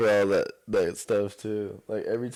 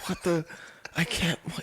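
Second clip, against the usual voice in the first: higher-pitched, halting disbelief; mock speechless shock; 6 semitones higher, 5 dB quieter than usual. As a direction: act lost for words, pitched up.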